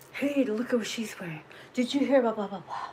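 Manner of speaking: whispered catty gossip; mock gossip whisper. Imitating gossipers about her outfit, in a whisper.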